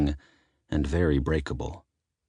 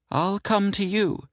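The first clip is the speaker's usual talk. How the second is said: high, eager aunt voice; woman voice. Acted. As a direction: offer help quickly. Sybil voiced by narrator.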